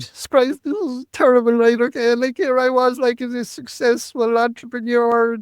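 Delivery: high, strained despair; anguished inner thought. Retold or imitated. Retold despairing inner monologue.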